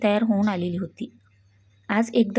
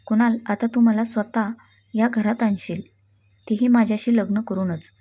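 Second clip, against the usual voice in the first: ordinary delivery, nothing marked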